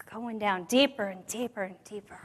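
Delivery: hushed whisper of sinking down. Real, not acted. Sinking into despair.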